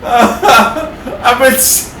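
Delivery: trying to talk through a giggle fit; laughing. Real, not acted. Gamer to viewers, laughing too hard to finish. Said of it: "I can't stop laughing here".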